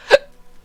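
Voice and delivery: sudden sharp hiccup; a real hiccup caught mid-talk. Real, not acted. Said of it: "I got the hiccups again".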